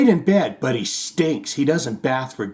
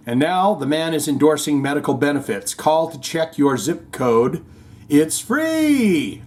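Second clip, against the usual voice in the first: usual speaking voice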